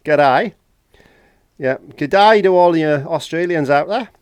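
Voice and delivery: loud, slow mock Australian g'day; hammed-up Aussie greeting, loud; a playful Australian accent, drawn out. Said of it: "what part of Australia".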